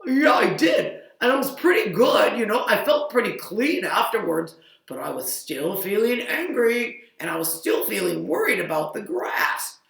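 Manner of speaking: high, loud upset cow; a child's cow voice getting worked up; loud fretful farm cow. Acted.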